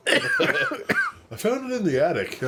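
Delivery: high laughing line. Real, not acted. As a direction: giggle through it. Joking banter about old weed.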